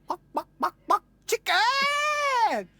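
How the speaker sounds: very high clucking, last word drawn out; chicken taunt. Retold or imitated. Drummer taunting the knife man, high mock chicken squawk.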